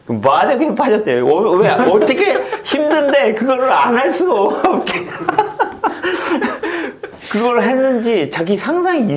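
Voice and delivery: high, loud and laughing as he retells the player's amazement; excited retelling. Retold or imitated. Coach retelling a former player's praise to interviewer, high laughing excitement. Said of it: "그런 얘기를 직접 하는 거예요".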